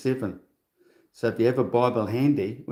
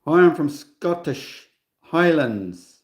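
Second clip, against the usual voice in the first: hammed-up Scottish greeting, higher and drawn out; bad Scottish accent; 5 semitones higher, 4 dB louder, slower than usual. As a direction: put on a badly faked Scottish Highlands accent. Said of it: "that's a bad accent".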